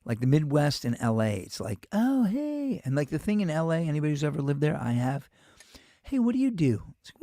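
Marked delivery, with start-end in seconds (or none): 1.9-2.8 much higher, sing-song fake nice; mock fake-friendly greeting. 6.0-6.9 higher, breezy networking tone; mock schmoozing question.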